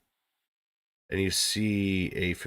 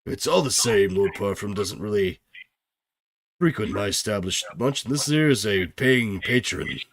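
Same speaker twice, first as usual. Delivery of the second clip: innkeeper voice; 5 dB louder than usual. Acted. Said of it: "he says".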